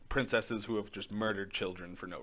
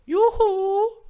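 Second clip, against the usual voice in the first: high, loud singsong Norwegian yoo-hoo; Norwegian accent; 15 dB louder than usual. Retold or imitated. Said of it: "the generic Norwegian accent".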